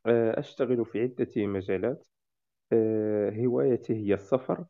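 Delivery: formal Modern Standard Arabic self-introduction. Real, not acted. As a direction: speak careful classroom fusha. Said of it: "قدم نفسك بالفصحى".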